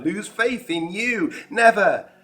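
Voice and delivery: high, loud, slow incredulous outburst; indignant disbelief. Retold or imitated. Thomas rejects Jesus' prediction, loud incredulous outburst. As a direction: scoff at the very idea, rising to a loud never.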